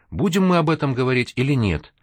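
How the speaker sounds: parry the complaint with energy; energetic retort. A narrator's reading. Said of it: "энергично парировала его возмущение Лика".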